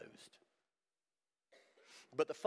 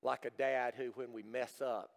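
usual speaking voice

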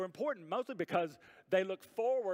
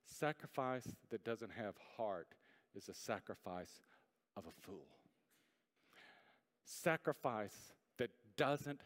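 low, soft, slow delivery with long pauses; quiet, weighty pulpit reflection; hushed solemn preaching; 6 semitones lower, 7 dB quieter than usual. Real, not acted. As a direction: let each phrase sink in gravely.

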